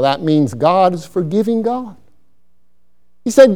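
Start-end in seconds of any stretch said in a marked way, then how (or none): none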